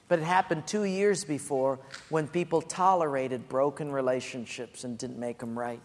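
ordinary delivery

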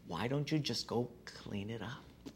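soft unvoiced whisper. God repeating the nudge to the pastor, in a whisper. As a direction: breathe it like a voice in your head.

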